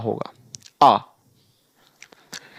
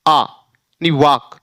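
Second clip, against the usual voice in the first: slow demo; 2 semitones lower, much slower than usual. Shown as a demonstration. Teacher slowly modelling the aa sound in walk. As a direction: slowly draw out "आ" and "वाक". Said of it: "यानी यहाँ अब इसको क्या पढ़ेंगे?".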